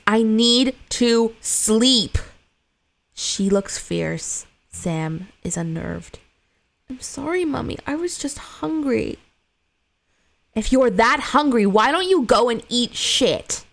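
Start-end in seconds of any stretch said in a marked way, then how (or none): 0.1-2.2 slow, louder, pressed-out insistence; snapping. Acted. Tired mother snapping at her son, slow and louder. 6.9-9.2 put-on scared little boy. Frightened son apologizing to his mother, high little voice. 10.5-13.6 furious yell. Acted. Mother yells at her son.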